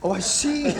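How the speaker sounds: usual speaking voice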